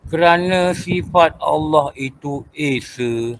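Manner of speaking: every word drawn out for note-taking; slow dictation. Acted. Teacher dictating a tauhid point to students, slow and deliberate. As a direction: dictate it slowly so the class can write.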